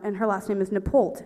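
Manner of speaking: usual speaking voice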